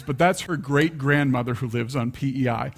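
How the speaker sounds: usual speaking voice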